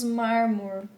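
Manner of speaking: "mármore" cut to "mármor", no final E; apocope. Shown as a demonstration. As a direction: stop on the R, dropping the E of "mármore". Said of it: "suprimiram aqui a letra E". ("mármore" sem o E final, como "mármor")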